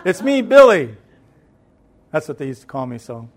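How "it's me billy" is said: loud, raised-pitch answer through a door; calling out. Retold or imitated. Grandchild answering grandma from outside the door, calling out. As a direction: holler back so she can hear.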